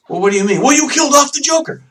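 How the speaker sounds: high, quickening imitation of an indignant developer; incredulous protest. Developer protesting to the publisher, mock incredulous. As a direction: object in mock disbelief, voice climbing high.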